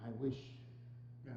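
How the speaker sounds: quiet regret. Acted. Scrooge grieves for his lonely self.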